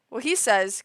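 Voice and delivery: normal talking voice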